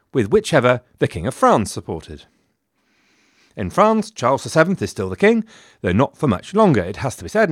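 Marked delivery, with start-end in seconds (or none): none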